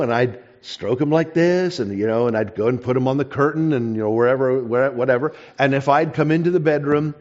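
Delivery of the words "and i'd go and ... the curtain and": normal talking voice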